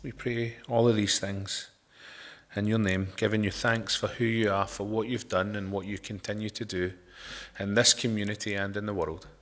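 soft, low, hushed prayer. Acted. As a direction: pray it quietly and reverently. Closing prayer to the congregation.

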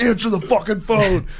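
yell, annoyed at mom; angry yell. Retold or imitated. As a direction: shout up the stairs at mom. Angry teen yelling at mom.

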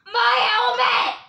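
long, high, loud shout; slow high yell. Pretend. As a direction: bellow it slowly in outrage. Toy character yells over lost helmet.

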